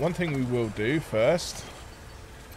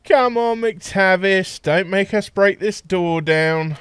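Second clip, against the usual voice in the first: shouting; 6 semitones higher, 6 dB louder, slower than usual. Acted. Shouting through a locked door.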